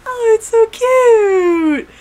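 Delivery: very slow, high-pitched squeal of delight; mock gushing. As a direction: stretch it out, swooning like a fangirl. Voices Kuguru gushing over couple.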